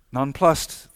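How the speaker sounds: usual speaking voice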